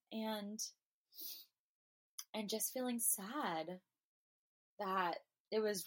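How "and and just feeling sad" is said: normal talking voice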